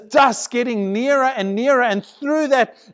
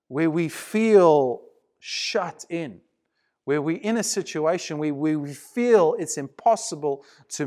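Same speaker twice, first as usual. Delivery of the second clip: hushed sermon reflection; 3 semitones lower than usual. Acted.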